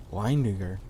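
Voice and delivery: usual speaking voice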